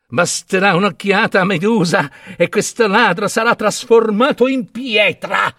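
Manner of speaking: high crone voice breaking into a cackle; gloating hag. A narrator's reading. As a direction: gloat wickedly, then cackle. Hag gloats to her sisters.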